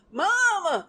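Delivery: loud mock cry of Mama; hammed-up grieving shout; mock wail. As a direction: wail the name in mock grief. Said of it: "I went".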